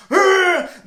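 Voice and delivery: loud, high-pitched frustrated roar; hammed-up "urgh" of fury; enraged growl. Esau's outburst on being cheated.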